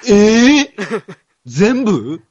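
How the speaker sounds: high, loud, laughing disbelief; surprised protest. Real, not acted. Asked to read it all again, surprised laughing protest. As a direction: balk at the request with a high, drawn-out yelp. Asked to repeat whole reading.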